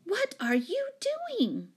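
high, dismayed demand; aghast scolding question; shocked exclamation. A narrator's reading. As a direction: start high, drop on the last word. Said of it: "exclaimed Jane".

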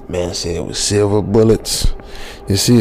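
normal talking voice

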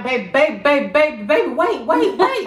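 rising panic, babe over and over, then wait wait; urgent pleading. Retold or imitated. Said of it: "she'd be like".

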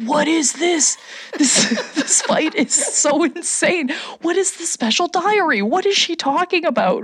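high incredulous questioning, some laughter; amused, high-pitched bafflement; bewildered reaction. Retold or imitated. Acting out own bewildered reaction.

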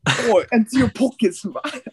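high-pitched mock British mugger. Playing a mugger for laughing friends, British accent. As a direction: demand it like a cartoon British street thug. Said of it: "a British person came up to mug me".

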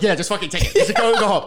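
a hammed-up annoyed passenger, cracking up; laughing mock passenger. Voicing a fed-up passenger to a friend, laughing.

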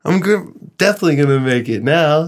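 retold pissed-off defiance. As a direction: act out stubbornly proving someone wrong. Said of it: "I was all pissed".

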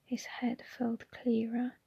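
plain reading voice

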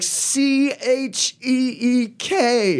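high, slow letter-by-letter mock spelling; mimicking the brother spelling out the word; spelling it out slowly. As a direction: spell each letter like talking to a child. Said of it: "he spelled it out".

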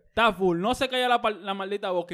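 high, loud, fed-up outburst; exasperated rant. Acted. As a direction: snap in exasperation at noisy passengers. Acted rant at the noisy passengers.

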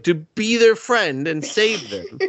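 high, slow sing-song mockery; high slow sarcastic sing-song; mock sweet. Mocking the plot.

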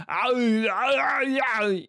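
loud, high, awful strongman howl; drawn-out imitation yowls, pushed hard; Arnold Schwarzenegger. Mocking people who impersonate him, awful Arnie noise. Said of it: "doing that awful fucking noise".

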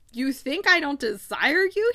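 mildly acted disbelief, leaning on DESIRE; wounded protest, rising in pitch; choked-out protest. A narrator's reading. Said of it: "he choked out".

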